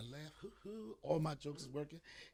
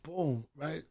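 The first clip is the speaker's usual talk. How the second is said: ordinary talk, nothing marked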